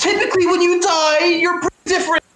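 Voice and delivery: very high comic panic; high-pitched, hammed-up freak-out; panicked. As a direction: wail it high in alarm. Client afraid that he will die.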